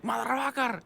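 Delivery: high mock scream at moderate volume; retold high-pitched ranting; imitated freak-out. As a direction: curse like a man losing it. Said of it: "he was freaking out".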